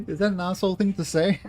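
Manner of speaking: high, loud, still laughing; laughing question. Real, not acted. Streamer asking chat while still laughing. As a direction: ask through leftover laughter.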